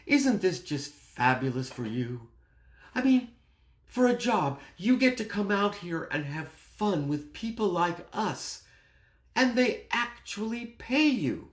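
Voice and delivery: gasp, then gush high and loud; put-on high voice of a delighted guest's wife; hammed-up wide-eyed delight. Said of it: "his wife says to me".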